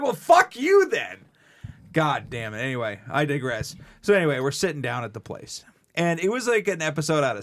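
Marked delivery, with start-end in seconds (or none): 0.0-1.2 high, loud, slow retort; mock angry retort. Retelling his reply to his sister, mock angry. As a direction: snap it back at her. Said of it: "I'm like".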